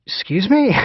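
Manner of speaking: high, stunned "Excuse me?"; shocked disbelief. Retold or imitated. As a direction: replay the jolt of hearing it. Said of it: "I was completely shocked".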